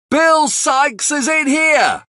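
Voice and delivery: very high, slow, long call; calling out to the crowd. Acted. Charlie alerts the mob outside, very high calling shout. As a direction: shout out the window to the street. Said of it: "he shouts".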